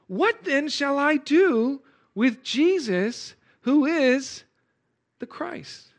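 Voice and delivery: high, slow questioning plea; Pilate asking. Retold or imitated. Pilate asks the crowd what to do, high and slow. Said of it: "asking them".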